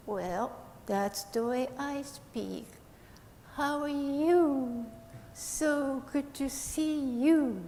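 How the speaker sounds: slow, drawn-out puppet speech; slow sloth voice. Acted. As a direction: speak slowly like a sloth. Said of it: "you speak so slow".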